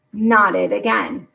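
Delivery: usual speaking voice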